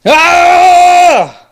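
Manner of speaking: high sudden battle cry; attack yell. Acted. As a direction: shriek as you strike. Player attacks with dagger.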